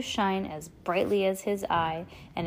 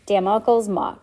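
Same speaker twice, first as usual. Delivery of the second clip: plain reading voice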